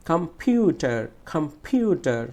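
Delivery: Computer with a strong long "piu"; stressed middle syllable. Shown as a demonstration. Teacher showing students how to say it, forceful "piu" in computer. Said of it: "পিউটা আমাকে জোরে বলতে হবে".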